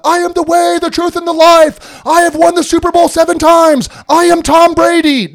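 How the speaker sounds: mock triumphant champion voice; triumphant church version. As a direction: boast it like a Super Bowl winner. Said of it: "the church turns it into".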